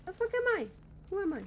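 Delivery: sudden high startled yelp; high-pitched what-the-hell. Real, not acted. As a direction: blurt it out in shock. Startled outburst in game.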